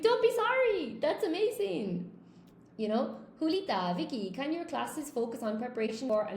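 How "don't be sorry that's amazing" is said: high, emphatic encouragement, acted out; retold enthusiastic reassurance. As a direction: reenact gushing reassurance in a much higher voice. Acting out reassuring an apologetic student.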